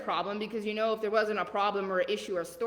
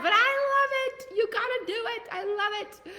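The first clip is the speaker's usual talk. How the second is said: gushing; 13 semitones higher, 4 dB louder than usual. Acted. Gushing after mentioning Southern accent.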